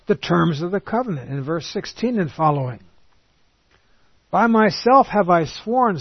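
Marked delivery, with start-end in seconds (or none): 4.3-6.0 slow and high, proclaiming; solemn scripture reading. Acted. Preacher voicing the Lord's oath, slow and raised. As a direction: announce the oath with weight.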